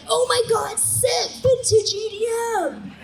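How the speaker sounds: put-on high, gushing hype-boy voice; bright mock-teen rave enthusiasm; excited young DJs. Two young DJs hyping up Suze, excited gushing.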